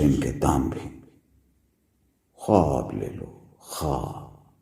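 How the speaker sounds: low, soft mumbling that fades away; muttering. Acted. Junk seller alone at night, fading muttering. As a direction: mutter it to yourself, trailing into near silence. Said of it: "फिर बड़बड़ाता हूं".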